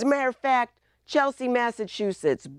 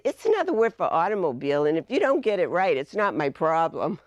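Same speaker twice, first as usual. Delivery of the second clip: brisk teacher; faster than usual. Retold or imitated. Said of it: "I said".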